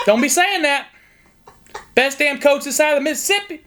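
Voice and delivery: folksy southern Indiana hillbilly accent played for laughs. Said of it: "if I do that accent".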